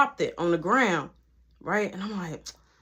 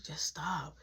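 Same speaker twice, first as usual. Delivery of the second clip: hushed, imitated comforting; soft consoling murmur; 5 semitones lower, 15 dB quieter than usual. Boyfriend hushes his crying ex, soft and consoling. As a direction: say it low, as if into her ear. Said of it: "I'm just talking in her ear".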